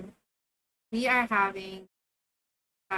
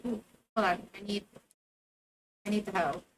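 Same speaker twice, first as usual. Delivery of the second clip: ordinary talk, nothing marked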